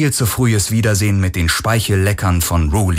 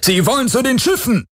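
high, urgent alarm; sudden alarmed warning; alarmed cry. Acted. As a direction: blurt it out in panic. Alarmed cry in action scene.

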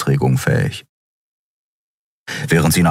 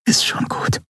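soft unvoiced whisper, softer still; 3 dB quieter than usual. A narrator's reading. Endriel holding Keru, repeating it in a whisper. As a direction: barely breathe it, no voice. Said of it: "flüsterte sie".

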